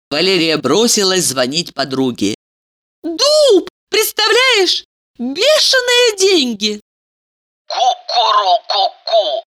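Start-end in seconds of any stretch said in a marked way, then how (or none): 3.0-6.8 put-on high thrilled girly voice; bubbly sorority girl. Valeria thrilled on phone. 7.7-9.4 admiring coo. Acted. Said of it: "восхитилась Елизавета".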